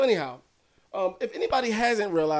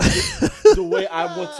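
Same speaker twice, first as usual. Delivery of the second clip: laughing; 6 dB louder, slower than usual. Real, not acted. Laughing mid-sentence.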